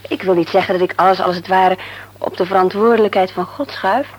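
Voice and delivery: normal talking voice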